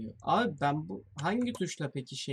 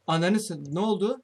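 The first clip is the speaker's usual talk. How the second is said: startled shout; 2 semitones higher, 8 dB louder than usual. Real, not acted. Startled by jump scare.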